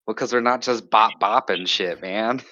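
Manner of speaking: usual speaking voice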